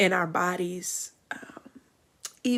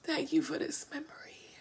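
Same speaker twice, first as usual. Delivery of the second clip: breathy whispered thanks, high and intimate; grateful whisper; 7 semitones higher, 10 dB quieter than usual. Real, not acted. Grateful pause after memory.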